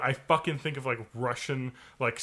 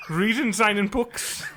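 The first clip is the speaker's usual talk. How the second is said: higher, hammed-up German accent; mock German; 6 semitones higher, 3 dB louder than usual. Friend joking about German Reading Rainbow, mock German. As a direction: ham up a fake German host.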